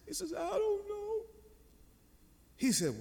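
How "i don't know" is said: put-on high, soft little-boy wail; mock child sobbing voice; imitated crying. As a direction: mimic a tearful five-year-old, small and high. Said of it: "And he's crying".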